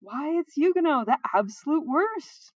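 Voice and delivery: loud, high groan of distaste. A narrator's reading. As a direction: drag it out in disgust. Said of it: "Balsa groaned".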